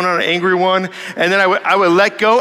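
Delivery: ordinary talk, nothing marked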